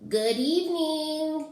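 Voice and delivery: high, slow, silky greeting. A narrator's reading. Second monster Ralph greets the kid in a high silky voice. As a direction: purr it out high and smooth. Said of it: "he said in a high, silky voice".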